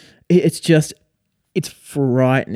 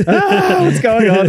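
high, loud yelp through laughter; hammed-up alarmed cry; mock panic; 10 semitones higher, 6 dB louder, slower than usual. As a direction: imitate a scammer freaking out. Mimics scammer panicking as webcam comes on.

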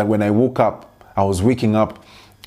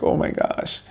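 breathy overwhelmed whisper under the breath; exasperated whisper; 5 dB quieter than usual. Retold or imitated. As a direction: whisper it as if it all weighs on you. Overwhelmed, retelling exasperated moment.

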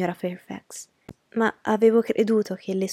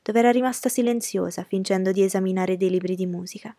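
ordinary delivery, nothing marked

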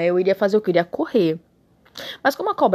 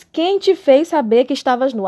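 stern God voice; 6 semitones higher, 7 dB louder than usual. Acted. God's question read aloud.

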